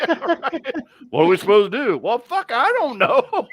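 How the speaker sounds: high, loud words breaking into laughter; laughing mock helplessness. Acting out clueless helpers, laughing mock helplessness.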